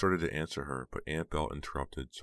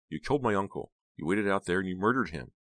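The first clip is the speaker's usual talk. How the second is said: higher, louder accusing delivery; heated accusation; 5 semitones higher, 6 dB louder than usual. Acted. Heather confronts her uncle's killer, heated accusation. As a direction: accuse him with some heat.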